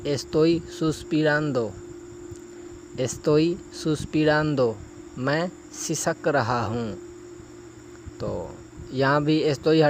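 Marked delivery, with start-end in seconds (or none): 0.1-4.7 slow, careful Spanish, each syllable clear; slow model phrase. Shown as a demonstration. Teacher modelling a Spanish phrase for students, slow and careful. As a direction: model it slowly for learners. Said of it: "اسی طرح آگے".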